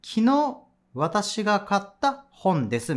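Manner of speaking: slow, deliberate word-by-word Japanese rendering; ゆっくり訳し読み; slow back-translation demo. Shown as a demonstration. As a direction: spell out the backward translation slowly. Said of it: "どうなるかって言ったら".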